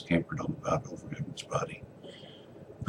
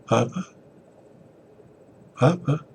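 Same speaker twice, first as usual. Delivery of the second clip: calling out; 10 semitones higher, 5 dB louder than usual. Acted. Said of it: "called out quietly at first".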